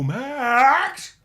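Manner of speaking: high, loud cartoon Grinch squeal; Jim Carrey's Grinch. Showing off his Grinch impression to his cohost, high and loud. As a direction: belt it out like the Grinch greeting his dog. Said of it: "Come on, just show me".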